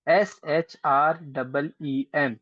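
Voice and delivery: spelled out slowly. Shown as a demonstration. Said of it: "फिर श्रीम".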